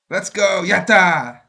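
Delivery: high, loud victory cry; jubilant cheer. Real, not acted. Celebrates reaching the goal.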